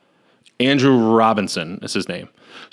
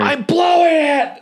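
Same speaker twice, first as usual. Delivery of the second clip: much higher, drawn-out mock wail; mock despairing wail; slower than usual. Host failing at the puzzle box, mock despairing wail. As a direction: wail it slowly in hammed-up despair.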